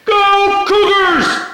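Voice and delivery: very high, drawn-out booming cheer; loud high rallying cry, stretched out; imitated yell. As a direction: holler it slowly like a one-man pep rally. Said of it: "he once yelled".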